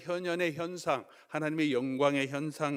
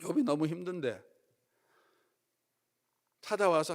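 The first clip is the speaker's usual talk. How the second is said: ordinary talk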